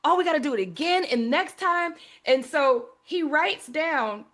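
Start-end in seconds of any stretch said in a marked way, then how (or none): 0.0-1.9 high, gushing to dad; retold giddy excitement, pitched up; excited daughter. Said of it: "I was so excited".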